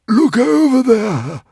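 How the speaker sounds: high, loud, panicking cry; barely holding back the panic; panicky. A narrator's reading. Dragon urging Hiccup to look, high panicky cry. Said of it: "trying unsuccessfully not to panic".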